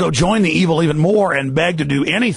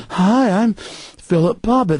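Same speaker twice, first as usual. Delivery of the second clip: slow, higher, mock-friendly introduction; nice public persona; 4 semitones higher, slower than usual. Host mocking an official at events, sugary nice voice. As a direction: introduce yourself with exaggerated niceness. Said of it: "says it real nice in a nice, friendly".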